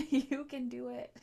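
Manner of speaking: laughing self-encouragement. Real, not acted. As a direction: cheer yourself on through a giggle.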